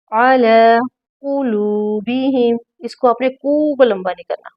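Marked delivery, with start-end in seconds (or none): none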